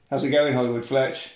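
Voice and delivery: ordinary talk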